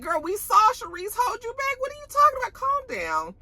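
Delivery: high-pitched exasperated disbelief; squeaky-high mock telling-off; incredulous protest. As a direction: call out an obvious denial. Acted incredulous reply to a denial.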